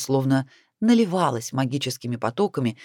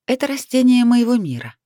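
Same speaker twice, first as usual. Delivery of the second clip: higher, louder heroine's reply; voiced young heroine; 10 semitones higher, 6 dB louder than usual. Heroine telling mentor about the plant, higher voice. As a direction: explain it in a raised, animated pitch.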